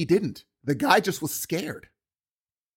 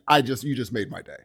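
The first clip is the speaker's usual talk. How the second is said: ordinary delivery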